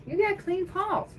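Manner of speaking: high, drawn-out cooing to a cat; pet-talk voice. Real, not acted. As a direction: coo slowly, way up high, to your cat. Talking to the cat about its paws.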